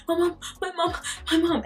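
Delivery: high, breathless mock plea for mum; unable to speak properly. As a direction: reenact a panicked, tearful cry. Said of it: "I could not speak properly".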